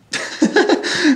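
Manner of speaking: high-pitched laugh; a second of real, high laughter. Real, not acted. Laughs after stating simple phrase.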